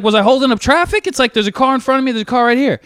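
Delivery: high-pitched arguing back; retold indignant protest. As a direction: replay the argument with mock indignation. Retold argument with the driver.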